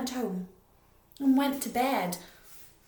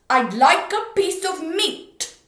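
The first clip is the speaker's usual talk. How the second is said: slow, loud booming repeat; booming again slowly; 7 semitones higher, 15 dB louder, slower than usual. Acted. Mister Noisy repeating his order slowly and booming. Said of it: "said Mister Noisy again".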